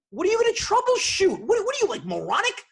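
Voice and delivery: high, loud incredulous shout; irate outburst. Retold or imitated. Customer snapping at support agent who wants to troubleshoot, irate shouting. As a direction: throw the word back in outrage.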